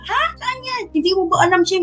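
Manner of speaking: surprised husband. Retold or imitated. Husband hearing about the house, mock surprised. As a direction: imitate his shocked reaction. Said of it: "Nagulat siya".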